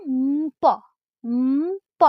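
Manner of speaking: উম, প sounded as "mmm-po", twice; conjunct pronunciation demo. Shown as a demonstration. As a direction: hum a long loud "mmm", then pop into প. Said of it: "ময় পয় সংযুক্ত কিরকম?".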